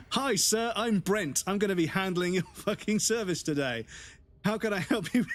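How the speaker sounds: high, gushing sing-song greeting; over-the-top server. Retold or imitated. Mocking eager server Brent greeting a customer. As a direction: beam like a too-eager waiter. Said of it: "over the top".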